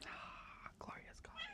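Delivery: breathy whispered relief; blissful whisper. Real, not acted. Savouring the first sip of coffee, whispered. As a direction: sigh it out over the coffee.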